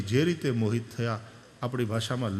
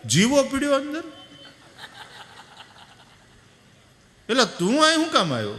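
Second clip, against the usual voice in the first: much higher, loud and fast surprise; surprised exclamation; 12 semitones higher, 5 dB louder, faster than usual. Retold or imitated. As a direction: react with amused surprise. Old villager surprised at Jivo. (નવાઈથી ઊંચા અવાજે)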